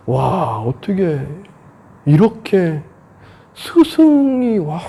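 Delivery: slow, halting wonder at a teacher; reverent awe. Acted. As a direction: marvel in awe, words drawn out. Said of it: "존경심에 푹 빠져요".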